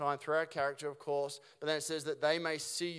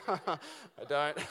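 self-mocking laugh; 2 semitones higher, 4 dB quieter than usual. Real, not acted. Admits he has no mower.

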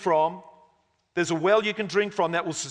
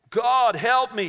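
high, loud cry to God; calling out for help; 6 semitones higher, 4 dB louder, slower than usual. A narrator's reading. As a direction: call up desperately from the pit. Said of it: "he called out".

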